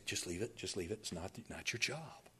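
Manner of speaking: low, very soft telling-off; quiet restraint. Acted. God telling angel it's not your job, hushed. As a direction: hush the eager helper, low and quiet.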